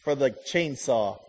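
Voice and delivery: sarcastic thanks. Retold or imitated. Wife thanking husband for an unwanted chainsaw, sarcastic. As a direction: thank him while clearly hating the gift. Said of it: "You're like".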